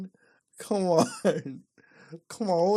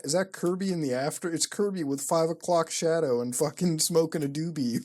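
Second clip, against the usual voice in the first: ordinary talk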